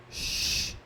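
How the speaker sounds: "X" as a quiet voiceless sh; sh sound. Shown as a demonstration. Teacher sounds out the next syllable block. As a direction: breathe out "X" as sh. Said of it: "vai ter som de".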